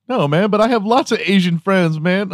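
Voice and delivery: high-pitched, defensive protest; mock pleading. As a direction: protest your innocence in a comically high voice. Keeps pleading he is not racist.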